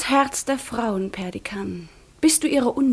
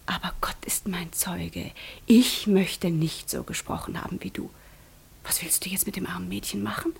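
low, bitter whisper; reproachful whisper; 3 semitones lower, 7 dB quieter than usual. Acted. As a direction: hiss the reproach under your breath. Reproaching him in secret. (vorwurfsvolles Flüstern)

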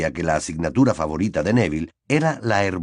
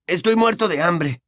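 hungry complaint; 8 semitones higher than usual. A narrator's reading. Ron complains of hunger.

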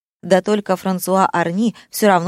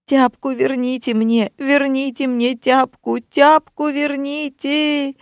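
high-pitched whine, stretching out the words; whining plea; 8 semitones higher, slower than usual. A narrator's reading. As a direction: beg like a wailing child, slower and slower. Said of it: "законючил Антон". (канючит, протяжно и высоко)

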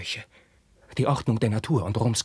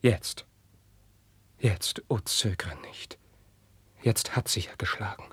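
urgent, voiceless plea; whisper. Acted. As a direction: press him in a whisper. Carlos urges his friend.

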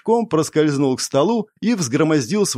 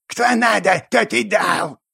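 higher, grating goblin snap; 7 semitones higher, slower than usual. A narrator's reading. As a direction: scrape it out like a grumpy goblin. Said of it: "проскрежетал в ответ гоблин".

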